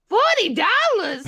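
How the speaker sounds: high, loud, drawn-out incredulity; mock shocked cashier. Imitating the cashier reading the screen, loudly incredulous. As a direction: squawk it as the appalled cashier. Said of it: "She goes".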